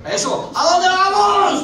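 high, slow, laughing shout; rallying shout. Real, not acted. Preacher urging the congregation on, slow laughing shout. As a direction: drag it out to fire them up.

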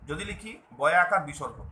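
normal talking voice

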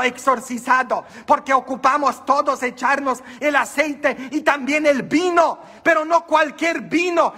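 rising preacher's cry, pressing on each phrase; rally the congregation; preaching hard. Acted. Preaching about the wine.

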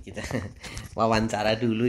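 ordinary delivery

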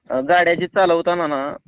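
ordinary talk, nothing marked

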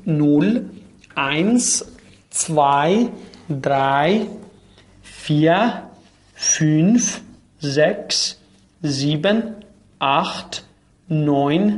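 each number set apart, clear, with a rising end; counting in German. Shown as a demonstration. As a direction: count nul to neun slowly, one number at a time. (شمارش آلمانی با مکث و تلفظ واضح هر عدد)

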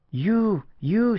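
high, loud, breathless with joy; fervent cry. A narrator's reading. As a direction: burst out in joyful disbelief. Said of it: "she cried fervently".

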